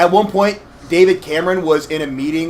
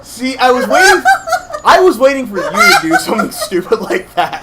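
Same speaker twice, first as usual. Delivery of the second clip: laughing hard; 7 semitones higher, 3 dB louder than usual. Real, not acted. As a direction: struggle to talk while cracking up. Both crack up at game mishap.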